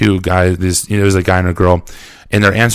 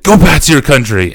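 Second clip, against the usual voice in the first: raised, higher hostile call; retold shout; 5 semitones higher, 4 dB louder than usual. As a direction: imitate a heckler shouting. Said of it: "just shouting this stuff".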